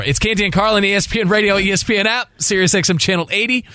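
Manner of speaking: upbeat show intro, smile in the voice; smiling radio intro. Real, not acted. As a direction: announce the show with a grin. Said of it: "If you hear a smile in my voice".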